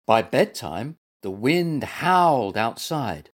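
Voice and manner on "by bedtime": plain reading voice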